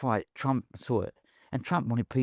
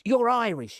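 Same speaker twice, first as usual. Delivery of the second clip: high-pitched mimicry of a promoter; rough impression of someone else, high; Don King; 11 semitones higher, 3 dB louder than usual. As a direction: imitate the promoter, voice pitched well up. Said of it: "I can't do his accent".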